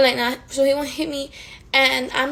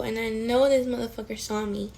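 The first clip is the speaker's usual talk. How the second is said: ordinary talk, nothing marked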